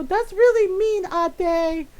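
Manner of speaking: high, loud complaint; hurt little brother. Retold or imitated. Brother complaining to his mean older sister, imitated protest. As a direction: protest like an upset kid. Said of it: "he'd look at her and go".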